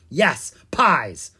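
loud, irritated snap; snappish. A narrator's reading. Pumpkin King answers Owen's doubt about pies, snappishly. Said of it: "the Pumpkin King snapped".

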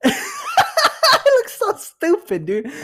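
real high, squealing laughter through the words; cracking up. Real, not acted. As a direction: barely get the words out for laughing. Cracks up at own reenactment.

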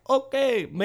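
high-pitched mock accent that slides toward Aussie; a botched island accent, jumping up high on okay; failed Jamaican accent. Joking about Red Stripe, a bad accent. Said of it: "that wasn't Jamaican".

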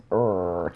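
deep rumbling animal growl; low growl. Shown as a demonstration. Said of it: "add a couple of growls".

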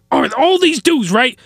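ordinary talk, nothing marked

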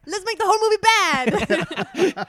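a hammy Frenchman proposing a terrible idea; mock French accent. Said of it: "I'm over this accent".

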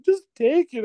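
very high squeaky plea; mock tearful. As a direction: imitate a woman insisting through tears. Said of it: "she's crying".

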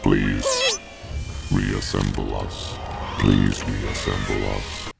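very low, slow robot voice; robotic monotone. Acted. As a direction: drone it like a broken droid. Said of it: "robotic voice effect and speaking in monotone".

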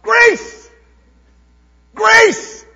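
"Grace!" cried out high and loud; joyful shout. Acted. Preacher to congregation, crowd shouting grace at the capstone, high loud shout. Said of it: "It was shouts of".

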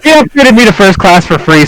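high voice just after laughing. Real, not acted. Justifying himself to laughing friends, still amused and laughing. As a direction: get it out on the tail of laughter.

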